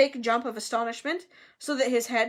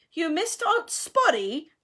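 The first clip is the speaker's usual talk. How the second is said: slow, raised, teasing jibe; taunting hiss; 6 semitones higher, 3 dB louder, slower than usual. A narrator's reading. Peeves goading Myrtle in her ear, taunting. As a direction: draw it out with spiteful glee. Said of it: "Peeves hissed in her ear".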